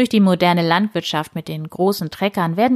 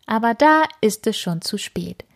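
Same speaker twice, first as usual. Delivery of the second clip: ordinary delivery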